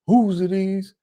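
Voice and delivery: mock the fiance's shrill demand; fiance's outraged demand. Fiance confronting Bobby over the drawers, accusing. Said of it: "And she's like".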